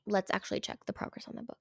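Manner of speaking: fast, breathy whispered aside. Real, not acted. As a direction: mutter it quickly to yourself. Quick aside to herself.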